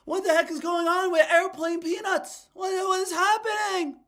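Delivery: high, loud, exaggerated comic whine; Seinfeld. Streamer jokes back at viewer's Seinfeld comparison, high loud impression. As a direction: push it way up high and loud in mock bafflement. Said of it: "Was I sounding like Seinfeld?".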